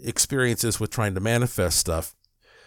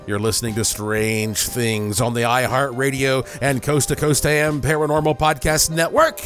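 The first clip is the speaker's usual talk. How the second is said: dramatic radio announcer read; ominous late night radio; 3 semitones higher, 5 dB louder than usual. Acted. Podcast station ident and break.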